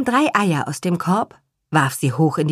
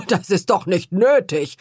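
protesting. Acted. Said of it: "protestierte Herr Kuchenbrand".

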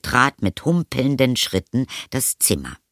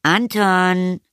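mother calling, higher pitched; 9 semitones higher than usual. A narrator's reading. Mother calls Anton for tea, calling out. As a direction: call it out to a child in another room. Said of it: "rief die Mutter".

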